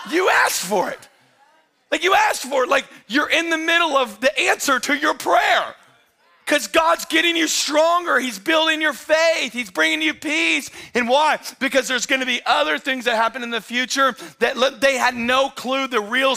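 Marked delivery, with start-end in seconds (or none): 0.1-2.7 laughing shout. Real, not acted. Repeats punchline, louder and higher. 2.7-13.1 higher, louder sermon shout; preaching at full force. Real, not acted.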